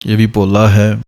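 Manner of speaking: ordinary talk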